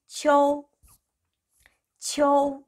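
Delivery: 치오 held high and level; one long, even high 치오; teacher's sustained 치오; model pronunciation. Shown as a demonstration. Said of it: "읽으셔야 합니다".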